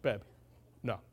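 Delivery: low, quiet meathead voice; Samson. Acted. Meathead husband refusing his wife, low dopey voice. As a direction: play a dull meathead, pitched right down. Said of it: "that's how Samson talked".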